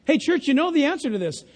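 normal talking voice

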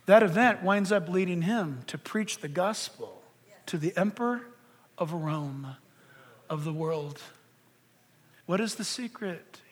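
very soft, low, weighty delivery; hushed preaching. Real, not acted. Preacher telling where Paul's suffering led, in a hush. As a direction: drop to a low hush and let it land.

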